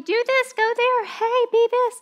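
high-pitched, mock pestering voices; distracting voices. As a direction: pipe up high like competing voices giving orders. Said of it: "so many distracting voices".

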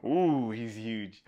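ordinary delivery, nothing marked

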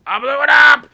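high, loud triumphant shout; excited shout. Real, not acted. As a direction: yell it out in triumph. Triumphant in game.

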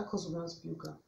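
ordinary delivery

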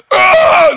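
high, loud raging yell; furious roar. Retold or imitated. Goliath enraged at David's words, furious roar. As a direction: let out the giant's enraged roar. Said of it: "The giant goes".

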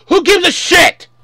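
a fan fed up with the prequels' politics, furious yell; real angry shout. Real, not acted. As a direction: shout it in disgust. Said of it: "I know I sound mad because I am mad".

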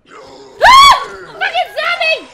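very high, loud shriek into shouted swearing; frightened scream. Real, not acted. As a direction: scream at a sudden zombie. Zombie jump scare.

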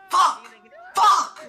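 loud, high curse of frustration; sharp panicked yell; frustrated outburst. Real, not acted. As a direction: burst out, stuck for an answer. Cursing, stuck for an answer.

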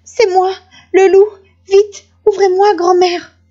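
high, urgent wolf begging to get in; urgent pleading. A narrator's reading. Wolf arriving first at grandmother's door, urgent pleading. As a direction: plead urgently, pitched well up.

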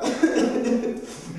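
high giggle; laughing at faked crying. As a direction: burst out laughing. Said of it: "je fais semblant".

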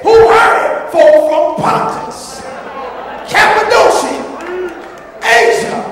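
high, loud preaching with drawn-out phrases; full-force preaching. Real, not acted. Preacher proving new birth to congregation, full-force preaching. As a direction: hammer each name and phrase home.

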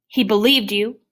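hurt accusation. Acted. Boy accuses doctor after friend's death.

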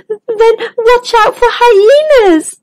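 high, slow shivery warning; a frightened zebra shivering as she speaks. Acted. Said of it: "shivered Zoe".

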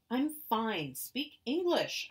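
loud, high, slow shout. Acted. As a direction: shout it in frustration. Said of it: "I was shouting".

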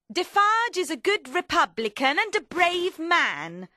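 high, loud, forceful character voice; Madame Defarge. Acted. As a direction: declare it high and hard. Narrator acts Madame Defarge's speech.

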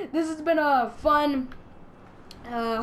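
ordinary talk, nothing marked

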